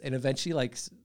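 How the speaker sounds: normal talking voice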